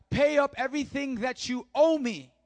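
loud, high acted threat from a creditor; angry demand. Retold servant demands while choking debtor.

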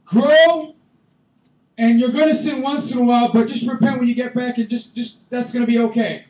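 high, loud hammed-up permissive preacher; loud mock permissive voice; mock lenient version. Shown as a demonstration. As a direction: parody a careless preacher, loudly. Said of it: "Is that what he's saying?".

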